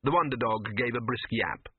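ordinary delivery, nothing marked

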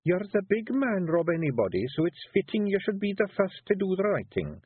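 high Scottish Feegle woman coaxing; wife coaxing her husband into writing. A narrator's reading. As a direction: push your husband gently, high and Scots. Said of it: "said Jeanie".

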